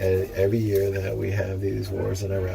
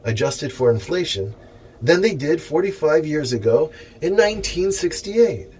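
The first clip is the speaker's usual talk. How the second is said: raised, louder reading-aloud voice, lifting each phrase; formal letter reading; 7 semitones higher, 5 dB louder than usual. Acted. Reading the minimum wage letter to the viewers, in a raised formal speech voice. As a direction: read the petition out like a speech.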